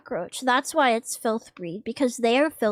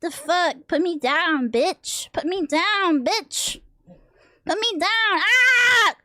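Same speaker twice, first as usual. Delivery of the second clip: put-on angry baby; 6 semitones higher, slower than usual. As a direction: throw a furious baby fit. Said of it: "She goes".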